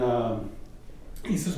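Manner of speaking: usual speaking voice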